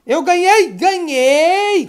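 loud, high celebration; excited victory cry. Real, not acted. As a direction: shout it out like a winner. Comemora a vitória no jogo. (comemoração empolgada, gritando)

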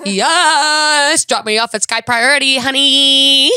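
exultant, hammed-up shout; loud diva cry. Passenger showing off to an Uber driver, loud diva cry. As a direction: crow it loudly, drawing out the last word.

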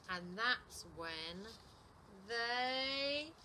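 very slow, then one long rising word; big storytime build-up; suspenseful build. A narrator's reading. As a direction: stretch "they" upward and leave it hanging. Suspense before the hug.

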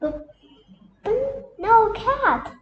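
high, loud eager stammer; bursting to get the cheese; excitedly. A narrator's reading. Minnie pleading about the cheese, excited. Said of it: "said Minnie Mouse excitedly".

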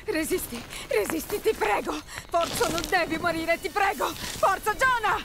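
high, urgent pleas for him to hold on; begging him not to die, voice strained; desperate pleading. Acted. Lara cerca di salvare Jonah. (supplica disperata, voce alta e tesa)

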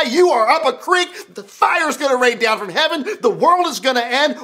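high, urgent fearmongering pile-up of threats; alarmist political ad. Acted. Preacher parodies election ads selling fear, alarmist and mounting. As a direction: build to a loud doomsday warning. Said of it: "sum up for you every political ad".

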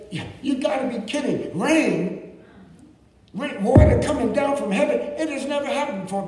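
high, incredulous mock of the scoffers; mocking naysayers. Naysayers ridicule Noah, high and scoffing. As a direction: sneer at the idea of rain. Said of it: "these naysayers saying".

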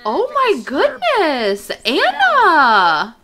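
scandalized and stretched out; gasping outrage at the game; shocked. Real, not acted. Shocked at Anna's insult.

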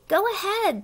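high, loud, eager urging; excited. Acted. Pollywog urges the caterpillar to start, excitedly. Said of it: "the pollywog said excitedly".

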